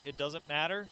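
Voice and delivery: ordinary talk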